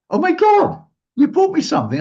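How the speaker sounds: high and loud, imitating her delight; thrilled gush. Friend unwrapping the liqueur box, squealing high and loud. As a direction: gush like she can't believe it. Friend gushing over gift.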